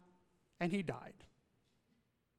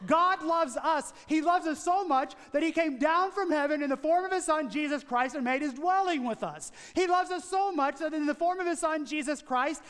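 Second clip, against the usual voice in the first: higher, driving sermon delivery; impassioned preaching; 5 semitones higher than usual. Real, not acted. Preacher telling congregation that God loves us, impassioned preaching. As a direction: preach it with rising urgency and emphatic key words.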